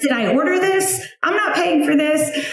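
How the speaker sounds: high, baffled mock-old-lady voice; puzzled high squeak, half-teasing; confused. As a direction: act out a confused old neighbor. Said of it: "the overall sentiment is just confusion".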